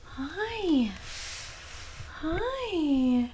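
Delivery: high, soft coo; soft greeting to pet. Real, not acted. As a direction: greet the kitten gently. Greeting cat softly.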